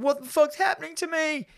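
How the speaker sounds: high-pitched mock panic; a frantic wail, hammed up; panicked high squeal. Retelling Anton freaking out.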